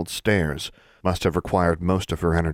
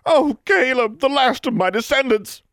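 much higher, louder, slowing theatrical wail; wailing lament; 5 dB louder than usual. Acted. Goody Pruitt wailing over the dedication. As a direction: mourn it like a melodrama.

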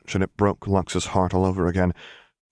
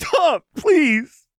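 high, loud tearful plea; crying out; 6 dB louder than usual. A narrator's reading. Lux begging Jinx to stop, crying. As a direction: cut in with an anguished cry. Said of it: "Lux cried into Jinx's hair".

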